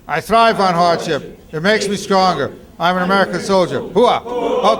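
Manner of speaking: loud rhythmic drill chant; loud gung-ho soldier. Shown as a demonstration. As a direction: chant it hard during push-ups. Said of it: "start saying it with me".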